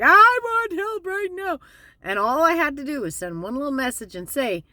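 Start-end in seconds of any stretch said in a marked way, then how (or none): 0.0-1.6 high, loud, drawn-out plea for help; exaggerated wail, slow and high; mock screaming. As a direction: act out a crying scream from inside your head. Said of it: "I'm screaming".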